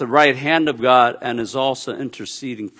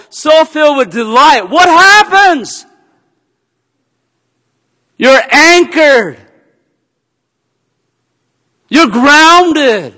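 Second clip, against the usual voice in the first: louder, higher preacher's climax; preaching at full force; 10 semitones higher, 8 dB louder than usual. Acted. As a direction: build it like a passionate pulpit crescendo. Preaching climax, rising intensity.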